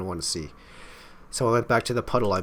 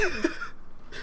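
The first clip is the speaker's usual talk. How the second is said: sudden high giggle; short breathy high laugh; 4 dB quieter than usual. Real, not acted. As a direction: burst out laughing at the twist. Player laughs at the twist.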